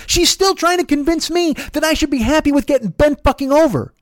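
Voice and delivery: very high-pitched, exasperated rant; outraged. Real, not acted. Driver venting about the passenger's excuses, outraged. Teller worked up over the quarrel.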